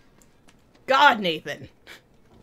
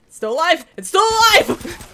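rising panicked scream, higher each time; terrified shriek; 10 semitones higher, 9 dB louder than usual. Real, not acted. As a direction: shriek it in horror. Horrified at surviving monster.

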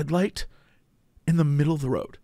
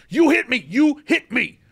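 higher-pitched mock outburst; angry accusation; 6 semitones higher than usual. Man accusing driver of hitting his car, mock-angry. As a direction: imitate an indignant man, punching each word. Retold quarrel, man's accusation.

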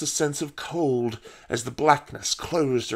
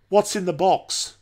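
higher-pitched, youthful asking; pressing question; 5 semitones higher than usual. A narrator's reading. Paul questions the old woman, higher and pressing. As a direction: ask it with a touch of urgency.

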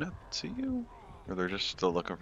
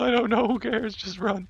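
frantic shouting; 9 dB louder than usual. Real, not acted. Urging teammate to flee.

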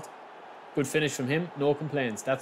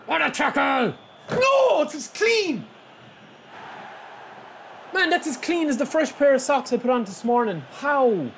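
outraged disbelief; 9 semitones higher than usual. Real, not acted. Streamer protesting a foul call on his tackle, incredulous shout. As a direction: insist it was clean, incredulous.

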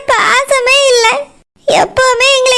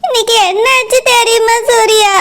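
crying on the phone. Acted. Daughter calls boyfriend, crying.

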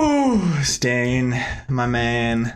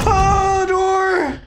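scared shriek; 6 dB louder than usual. Real, not acted. Jump scare in horror game.

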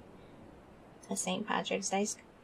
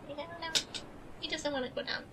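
higher, softer cooing voice; 9 semitones higher, 9 dB quieter, much faster than usual. Real, not acted. Telling chat the dog won't lie down, soft high coo. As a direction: coo softly over the dog.

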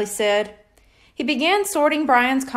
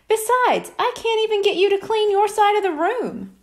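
mother's voice; 9 semitones higher than usual. A narrator's reading.

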